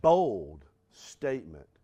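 ordinary delivery

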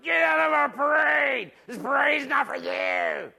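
imitating the crowd, loud high jeer fading to a sneer; mock hostile crowd. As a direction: play the annoyed parade crowd, shouting then trailing off. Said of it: "they're all looking at me like".

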